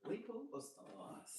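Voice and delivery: whispered boast. Acted. To the viewers, a whispered pooper stars boast. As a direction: breathe it out as a secret.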